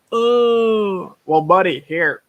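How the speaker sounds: loud, drawn-out, high "Oh"; mock realization. Booth guy reacts to the streamer's explanation, mock realization. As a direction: imitate a stranger suddenly understanding, big and loud.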